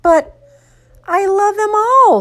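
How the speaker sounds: put-on high little-boy protest; high-pitched, pleading kid voice; crying out. A narrator's reading. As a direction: cry out like a child pleading for his toys. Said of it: "Spencer cried".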